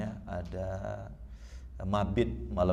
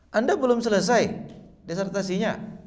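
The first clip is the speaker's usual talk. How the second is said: high, loud firm question from the supervisor; firm shout; 13 semitones higher, 6 dB louder than usual. Retold or imitated. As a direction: call out sharply like a stern professor. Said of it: "dia teriak, agak, suara agak tegas".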